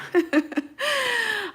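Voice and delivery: a bright high burst of laughter; amused self-conscious giggle. Real, not acted. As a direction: giggle at yourself, high and light. Laughs at own English.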